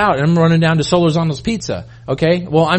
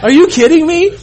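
shocked realization; 4 semitones higher than usual. Retold or imitated. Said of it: "We're like".

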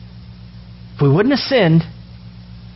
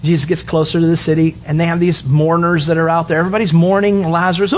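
ordinary delivery

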